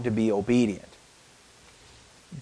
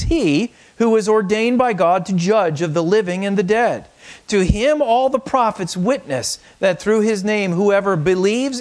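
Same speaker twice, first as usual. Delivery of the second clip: raised, proclaiming scripture voice; scripture reading; 7 semitones higher than usual. Acted. Pastor reading scripture aloud to the congregation, proclaiming. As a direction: read the verse out as a proclamation.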